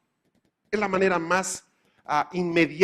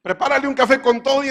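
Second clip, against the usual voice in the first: higher, louder order to the kitchen; called-out order; 9 semitones higher, 6 dB louder than usual. Retold or imitated. Server calling to the cook, higher and louder. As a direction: call the order back to the cook.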